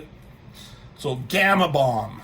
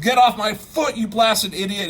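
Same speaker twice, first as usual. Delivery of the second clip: loud, high mock-angry yell; Hulk getting angrier; 7 semitones higher, 6 dB louder than usual. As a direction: act out losing your temper. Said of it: "He's just getting angrier and angrier".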